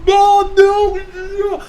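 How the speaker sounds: high, loud wail as if being dunked; hammed-up, drawn-out cry for Mom; imitated drowning yell. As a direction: yell desperately while going under water. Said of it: "As I dunk his head underneath the water".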